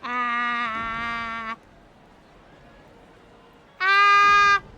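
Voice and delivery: ears covered, muffled voice. Real, not acted. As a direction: test sound with ears half covered. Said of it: "Maybe I am covering my ears too hard".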